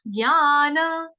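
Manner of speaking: ज्ञान drawn out slowly, ग्यान sound; slow clear ज्ञान. Shown as a demonstration. Teacher shows students how to say ज्ञान, slow and clear. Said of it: "उच्चारण देखेंगे इसका".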